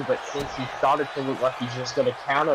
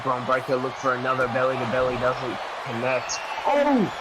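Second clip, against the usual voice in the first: wrestling commentator. Acted. Live play-by-play commentary.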